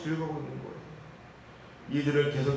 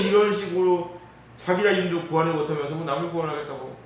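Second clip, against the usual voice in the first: ordinary talk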